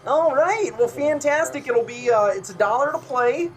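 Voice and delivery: high, delighted carnival patter; clown game host. Acted. Fisherman clown taking a new player, high cheery voice. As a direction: pitch it up as a chirpy stallholder. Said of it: "And she's like".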